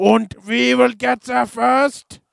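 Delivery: slow, high, hammed-up German accent. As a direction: drag it out like a mock German villain. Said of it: "That's a German accent".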